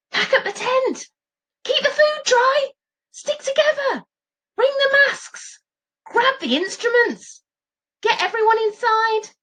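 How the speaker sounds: high, urgent, overlapping orders; frantic shouting. Acted. Tribe shouting in pandemonium.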